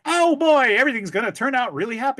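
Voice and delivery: high, gushing, sugary-sweet cheer; cheesy upbeat movie voice. Retold or imitated. Mocking how eighties movies sounded, sugary high cheer. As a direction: sell the happy ending like an eighties movie. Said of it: "all the movies were like".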